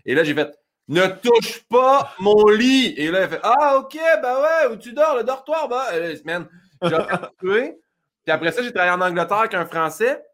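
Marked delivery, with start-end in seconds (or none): 0.9-2.9 loud, very slow, word by word; slow over-articulation. Retold or imitated. Telling French hostel roommate about the bed, loud slow over-articulation. 3.4-5.9 high, lively mock French voice; French roommate. French roommate finally understands in the hostel, high-pitched mock French reply.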